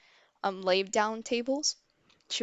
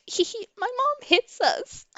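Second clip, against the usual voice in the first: put-on high, louder little-kid voice; giggly little sister; 7 dB louder than usual. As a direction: imitate a small child tittering. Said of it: "my sister here all smiling with her little juice".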